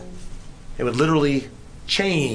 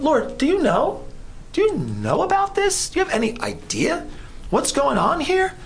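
high, pressing questions thrown at God; anxious plea to God; 10 semitones higher than usual. Retold or imitated. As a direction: voice a worried believer demanding answers from God. Preacher voices Israel questioning God.

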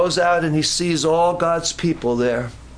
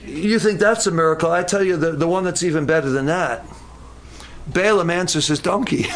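ordinary talk

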